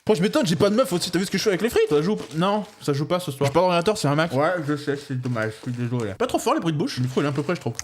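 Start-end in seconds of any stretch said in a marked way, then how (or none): none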